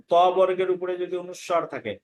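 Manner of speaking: ordinary talk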